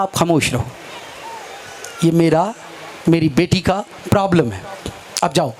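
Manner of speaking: low, controlled fatherly firmness; acted stern rebuff. Father sending away a meddling shopper, low firm voice.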